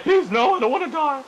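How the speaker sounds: high, loud mock begging; squeaky zombie begging for mercy; pleading zombie voice. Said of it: "And the zombie's like".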